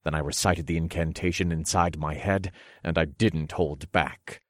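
ordinary delivery, nothing marked

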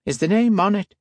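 high, thin, sly Irish voice; reedy Irish man. A narrator's reading. Buyer asks the priest, high and reedy Irish.

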